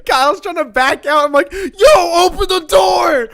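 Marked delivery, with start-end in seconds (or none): 1.5-3.3 loud high shout; locked-out friend shouting at the driver; yelling. Retold or imitated. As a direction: yell to be let in. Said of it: "as I'm yelling that".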